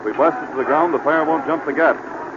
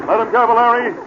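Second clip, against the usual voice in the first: calling out; 9 semitones higher, 4 dB louder than usual. Acted. Searching smoky hotel for her.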